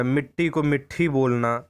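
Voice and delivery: "मिट्ठी" with aspirated ठ in place of ट; mispronunciation demo. Shown as a demonstration. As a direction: stress the wrong aspirated "मिट्ठी". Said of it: "ठा का उच्चारण कर देना". ("मिट्ठी" का गलत महाप्राण उच्चारण दिखाएँ)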